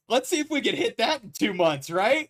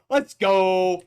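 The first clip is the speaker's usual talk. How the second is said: frat bro; 7 dB louder than usual. Real, not acted. Hype cheer to chat.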